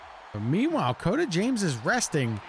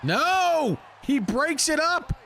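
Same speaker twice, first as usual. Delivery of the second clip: excited shout; 11 semitones higher, slower than usual. Real, not acted. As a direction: yell it as the pin breaks. Commentator reacts to a pin break.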